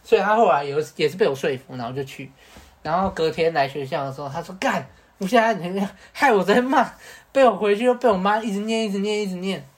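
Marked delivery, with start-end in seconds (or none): 4.6-9.6 whiny complaint. Retold or imitated. Friend complaining next day.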